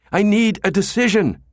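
"I need a decision" loud and high, hit hard on "need"; demanding. A narrator's reading. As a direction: press the director for an answer. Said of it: "Jabba demanded".

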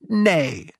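high, ringing cry of refusal; shouted refusal. A narrator's reading. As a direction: cry it out at the top of the voice. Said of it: "shouted the curate at the top of his voice".

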